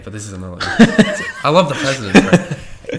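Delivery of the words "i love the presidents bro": words squeezed out through laughter; laughing. Real, not acted. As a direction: laugh through the line, higher and louder. Laughing at own tangent.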